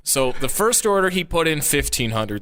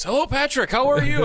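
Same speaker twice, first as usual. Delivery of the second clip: high mock-polite caller voice; imitating Jose; 12 semitones higher than usual. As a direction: play the overly friendly client. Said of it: "and he goes".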